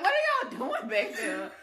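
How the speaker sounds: loud laughing question; calling out to the kitchen in laughing disbelief. Retold or imitated. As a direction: call it out laughing. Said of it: "I'm like".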